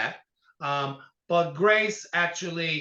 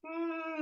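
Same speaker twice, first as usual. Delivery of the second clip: high, soft drawn-out hum; doubtful "mm"; 12 semitones higher, 10 dB quieter than usual. Real, not acted. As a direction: hum your reservations before the verdict. Hesitant verdict on [name].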